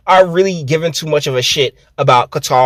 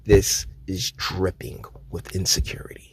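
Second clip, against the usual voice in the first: whisper; 7 semitones lower, 11 dB quieter than usual. Real, not acted. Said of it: "whispered aside".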